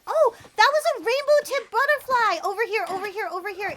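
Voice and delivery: loud, high burst of discovery; excited high shout; excited call-out. Real, not acted. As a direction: urgently call a friend over to a rare find. Spots rare butterfly, calls friend over.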